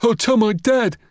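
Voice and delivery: high, wailing schoolboy threat; defeated bully's high whine; near blubbering. A narrator's reading. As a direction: threaten to tell, close to tears. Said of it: "was on the point of blubbering".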